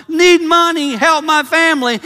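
high, loud plea for help; pleading beggar. Pretend. Preacher voicing a beggar's sign to the congregation, loud pleading. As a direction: cry out like a desperate beggar.

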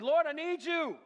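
very high, loud plea; impassioned shouted appeal; crying out to God. Retold or imitated. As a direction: cry out desperately in prayer. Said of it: "I was crying out to God".

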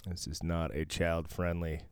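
ordinary talk

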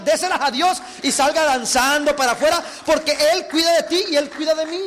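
loud, urgent pulpit exhortation; preacher rousing the congregation; fervent preaching. Acted. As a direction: build to a full-force shout.

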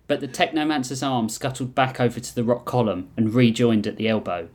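usual speaking voice

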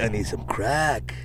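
hoarse whisper, slow; whispering addict. Pretend. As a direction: whisper it like a strung-out junkie. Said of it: "plays along as addict".

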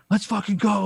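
high-pitched hype yell; coach yelling. Retold or imitated. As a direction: scream it like a fired-up coach. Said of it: "a coach just fucking screaming".

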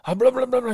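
high, loud rolling babble; aggressive mock calling. Hunter told to get aggressive on the call, high mock babble. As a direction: imitate an aggressive burst of turkey calling. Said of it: "Get aggressive with him".

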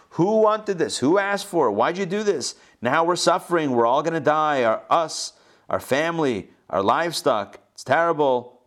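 high-pitched mock griping; complaining. Voices the people's complaint to Moses.

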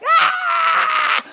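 a sudden, very high, loud shriek of fright; terrified scream. Retold or imitated. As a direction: shriek as if someone just jumped out at you. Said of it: "they're completely shocked and terrified, and their first reaction is like".

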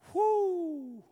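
high, loud, long "whoo"; drawn-out whoo. Real, not acted. Preacher reacting to the Lord's piercing look, drawn-out whoo. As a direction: let out one sustained high whoop.

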